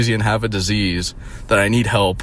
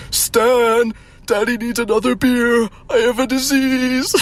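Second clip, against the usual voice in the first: high, hammed-up parody voice; mock whiny cartoon dad; 12 semitones higher, slower than usual. Mocking the man told he has a disease, high parody wail.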